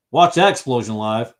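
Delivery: ordinary delivery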